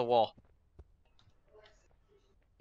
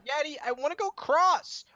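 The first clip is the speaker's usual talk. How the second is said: put-on high little-kid whine, louder on the end; pleading child; 11 semitones higher, 3 dB louder than usual. Child begs daddy to throw him across, high pleading voice. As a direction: beg like a small child.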